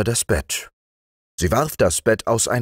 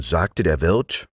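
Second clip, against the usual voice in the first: plain reading voice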